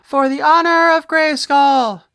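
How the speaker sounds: high, grand announcer read of the box text; packaging blurb read. Acted. Reviewer reading the back of the toy box to viewers, grand announcer style. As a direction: boom it out like a cartoon trailer.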